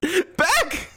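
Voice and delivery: high, drawn-out mock yell; hammed-up exasperated outcry; exasperated protest. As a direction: protest in disbelief, pitch climbing. Acted protest at commander's bad decision.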